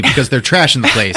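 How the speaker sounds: usual speaking voice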